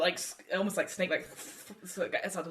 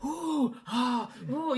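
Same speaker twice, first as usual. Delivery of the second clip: a higher, louder crowd going ooh and ah; impressed crowd; 7 semitones higher, 3 dB louder, much slower than usual. Retold or imitated. GM voicing the arena crowd watching the fight, impressed murmurs. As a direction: sound like a whole crowd wowed by the fight. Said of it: "the crowd going".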